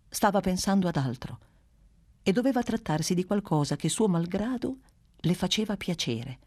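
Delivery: ordinary delivery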